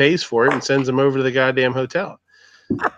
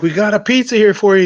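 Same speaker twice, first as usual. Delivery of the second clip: louder, higher call through the door; delivery call; 7 semitones higher, 6 dB louder, faster than usual. Retold or imitated. Pranksters at the door, loud higher call. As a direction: announce the pizza through the door. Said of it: "they're like".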